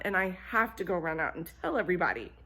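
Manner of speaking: fast, hurried gossip; rushed. Acted. Goose rushes off to spread gossip, fast. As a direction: rattle it off, eager to leave.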